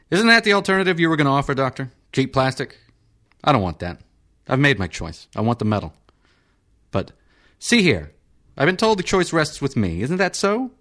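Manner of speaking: high, fast peevish patient voice; irritable senator. Acted. Senator insisting to the surgeon on metal, high peevish.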